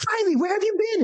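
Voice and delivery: high, put-on cry of welcome; mock effusive greeting. Artist waylaying Riley in the doorway, effusive greeting.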